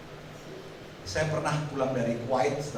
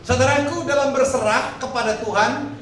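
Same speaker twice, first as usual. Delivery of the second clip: loud, raised-pitch scripture reading in slow phrases; preaching; 5 semitones higher, 6 dB louder than usual. Real, not acted. Preacher leads congregation reading the verse, loud slow declaiming. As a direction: lead the congregation through the verse at full voice.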